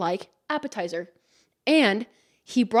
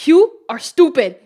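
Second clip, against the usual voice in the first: louder, higher, deadpan mock insult; blunt busboy insult; 4 semitones higher, 10 dB louder than usual. Busboy points at the hostess, louder, higher mock insult. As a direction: imitate him pointing and calling her stupid.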